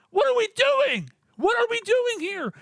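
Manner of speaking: high, disbelieving outburst; exasperated. Real, not acted. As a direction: pitch up in disbelief. Ranting at the coach.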